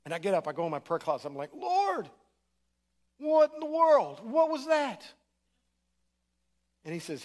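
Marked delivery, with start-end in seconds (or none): 1.5-5.0 high, loud, stunned outcry to the Lord; a frightened plea, pitched way up; alarmed cry to God. Retold or imitated. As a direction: cry out shaken and bewildered. Startled prayer after terrifying dream.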